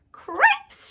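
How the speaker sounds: high, loud squeal of alarm; shouted. A narrator's reading. Furlough sees his brother with the princess, shrill shout. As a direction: yelp it out in shocked panic. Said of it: "shouted Furlough again".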